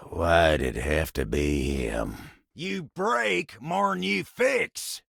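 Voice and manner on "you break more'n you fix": high, slow, indignant jab; exasperated accusation. Acted. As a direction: throw the accusation out high and drawn-out. Grumbling at the one who fixes.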